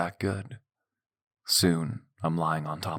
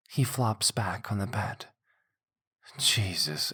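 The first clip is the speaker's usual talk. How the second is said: usual speaking voice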